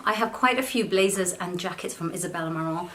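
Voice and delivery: usual speaking voice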